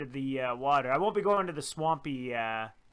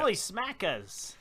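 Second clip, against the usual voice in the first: higher, drawn-out mock-Australian exclamation; Australian accent; 5 semitones higher, slower than usual. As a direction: draw it out in imitation Australian. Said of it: "I shouldn't do the Australian".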